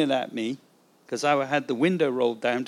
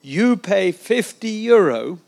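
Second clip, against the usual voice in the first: put-on louder, slow French-accented demand; 4 semitones higher, 6 dB louder, slower than usual. Corrupt policeman repeating his demand to the traveller, French accent. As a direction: imitate a French-speaking officer, slow and emphatic. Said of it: "I'm doing a French accent".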